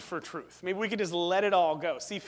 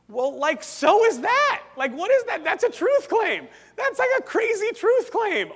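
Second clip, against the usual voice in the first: loud, high-pitched rebuttal; incredulous outburst; 12 semitones higher, 8 dB louder than usual. Real, not acted. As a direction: cry out at the absurd contradiction. Preacher's incredulous rebuttal of the claim.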